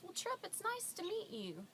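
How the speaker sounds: high-pitched imitation of a lady greeting a child; friendly woman. Woman meeting three-year-old Tripp, high friendly voice. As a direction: talk high, as if to a little kid.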